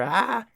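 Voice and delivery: high, loud delighted shriek; excited squeal. Real, not acted. The mail flag is up, delighted high shriek. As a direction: let out a happy scream.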